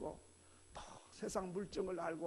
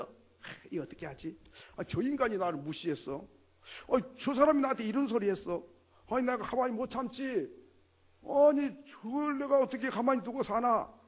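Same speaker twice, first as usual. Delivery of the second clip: sigh, then build to a louder indignant grumble; fretting and fuming; 3 semitones higher, 7 dB louder than usual. Retold or imitated. Preacher voicing a worldly person plotting revenge, rising indignation. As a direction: stew over the insult until it boils over. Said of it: "온갖 생각을 하고 고민을 하고".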